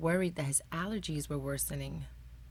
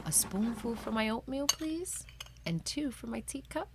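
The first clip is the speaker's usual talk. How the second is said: higher, sing-song little voice for Amos; Amos to the sugar bowl; 7 semitones higher than usual. Acted. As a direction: lift the voice up, sweet and light.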